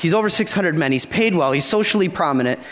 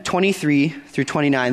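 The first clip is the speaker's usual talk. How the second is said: ordinary delivery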